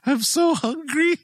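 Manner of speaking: high-pitched imitation of a starving spider; hungry whine. Retelling the spider's first meal, mock desperate hunger.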